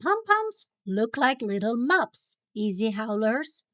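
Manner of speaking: plain reading voice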